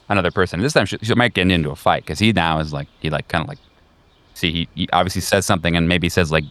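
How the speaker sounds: ordinary talk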